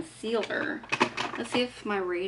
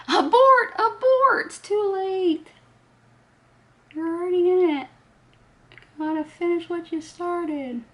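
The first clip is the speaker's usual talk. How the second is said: high, loud, hammed-up alarm; mock panic; 10 semitones higher, 5 dB louder than usual. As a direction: cry abort like a sinking ship's captain. Mock panic over makeup.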